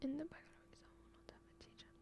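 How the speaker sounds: barely audible whisper; hushed, voiceless read-through; under the breath. Real, not acted. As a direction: whisper it to yourself while reading. Reading a student's comment to herself.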